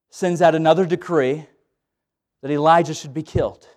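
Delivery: normal talking voice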